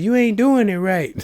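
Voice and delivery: high put-on sassy retort; mock retort. Comedian mocking stress eaters, high sassy voice. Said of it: "She said".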